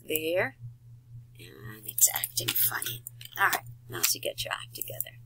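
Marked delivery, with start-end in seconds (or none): 1.4-5.2 hushed muttered aside to himself; whispered aside. Real, not acted. Instructor mutters to himself when the software acts up, whispering. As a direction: whisper it under your breath while the software glitches.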